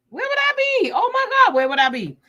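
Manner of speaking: high-pitched, loud cry of gratitude; overwhelmed, high exclamation to God; fervent outburst. Real, not acted. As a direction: cry it out like praise in church. Emotional outburst praising God.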